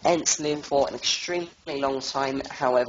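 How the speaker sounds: ordinary talk, nothing marked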